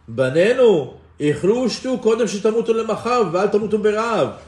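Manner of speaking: high, loud retold mother's plea; urgent pleading. Mothers urging their fasting children to eat, urgent pleading. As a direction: call out urgently to your children. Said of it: "and they tell them".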